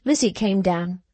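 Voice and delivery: ordinary delivery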